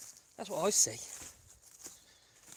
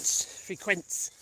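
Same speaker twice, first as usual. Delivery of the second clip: usual speaking voice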